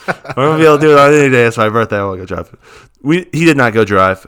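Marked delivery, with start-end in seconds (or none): 0.1-2.5 loud, fast, higher insisting; a tipsy birthday boy begging for the keys; mock drunk birthday plea. Wife retelling her husband's drunk plea, loud and fast. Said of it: "He's like".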